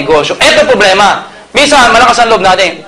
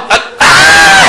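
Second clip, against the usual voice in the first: mock yelp. Woman poked on the train, sudden mock yelp. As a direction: cry out as you're poked.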